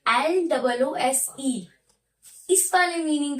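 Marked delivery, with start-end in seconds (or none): 0.1-1.7 letters spelled out slowly, one by one; slow spelling out. Shown as a demonstration. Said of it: "loose, loose का मतलब होता है ढीला".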